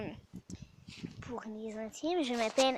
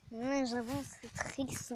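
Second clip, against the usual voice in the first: usual speaking voice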